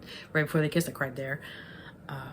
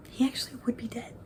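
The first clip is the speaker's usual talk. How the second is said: whispered, a bit higher; mock whisper; 7 semitones higher than usual. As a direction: hush it like a dark secret. Retold disbelief about Flounder.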